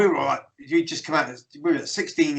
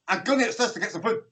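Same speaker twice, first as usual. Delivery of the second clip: fast, higher, run-together Scottish speech; rapid Scots mumble; 6 semitones higher, faster than usual. Retold or imitated. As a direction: rattle it off in quick Glaswegian. Retelling unintelligible fast Scots speech.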